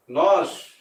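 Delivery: plain reading voice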